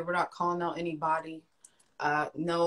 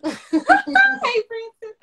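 much higher, louder, slow, laughing; laughing greeting; 12 dB louder, much slower than usual. Real, not acted. Greeting a viewer in the comments, laughing. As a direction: call his name through a laugh.

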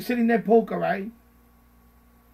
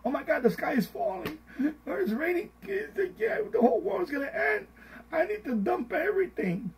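much higher, near tears, hysterical; mock hysteria; 10 semitones higher than usual. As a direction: wail like the sky is falling. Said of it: "You got emotional".

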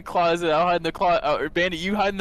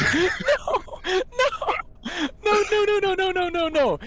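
despairing shout; much slower than usual. Real, not acted. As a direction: cry out in disbelief. Friends died, despairing.

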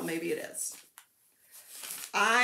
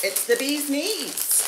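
ordinary delivery

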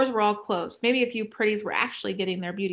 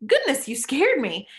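higher, louder startled gasp of words; 5 semitones higher, 5 dB louder than usual. Acted. Paris startled by Tally hiding in the elevator, surprised. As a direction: jump at the sight of her.

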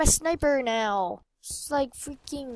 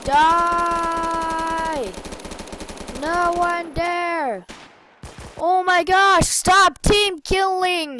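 angry yell; 6 semitones higher, much slower than usual. Real, not acted. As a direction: bellow at the team-killer. Fighting team-killer.

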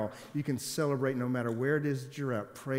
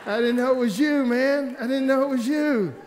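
much higher and much louder, overjoyed; loud excited disbelief; 12 semitones higher, 10 dB louder than usual. Retold or imitated. Telling his pilot cousin he never knew, excited shout.

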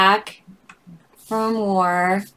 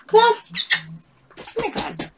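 calling the cat; 14 semitones higher than usual. Real, not acted. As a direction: call out to the cat across the room.

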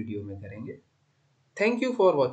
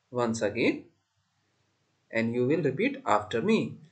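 ordinary talk, nothing marked

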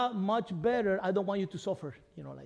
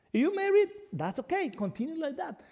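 raised, higher voice as Paul giving counsel; voicing Paul; 8 semitones higher than usual. Paul advising the unmarried, in a higher raised voice. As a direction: speak as the apostle, lifting the pitch. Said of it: "what does Paul want to let you know and say".

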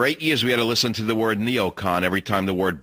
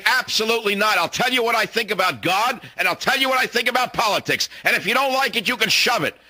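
defiant reply; 11 semitones higher than usual. Pretend. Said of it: "look 'em in the face and say".

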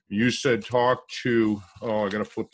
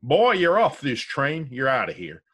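conductor's voice; 4 semitones higher, 6 dB louder than usual. Retold or imitated. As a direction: bark it out like a conductor throwing him off. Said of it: "The conductor said".

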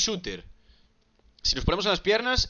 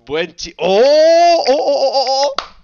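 excited laughing shout; 9 semitones higher, 13 dB louder, much slower than usual. Real, not acted. Cheering his partner pick.

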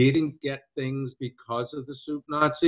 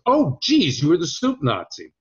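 mock astonished "oh, geez", starting high and loud; surprised recognition; 4 semitones higher, 4 dB louder than usual. Director suddenly recognizes the actor at an audition, mildly hammed-up surprise. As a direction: pitch leaps up in retold realization.

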